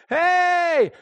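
sharp high call from up the trail; distant shout. Retold or imitated. People up the mountain hailing the climber, a high shout. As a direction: holler it out to a climber far below. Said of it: "the voices of people screaming".

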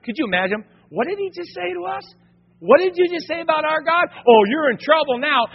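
high-pitched indignant squawk; hammed-up affronted disbelief; mock outrage. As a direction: play offended men, voice shooting up. Acted offended prophets react to taunt.

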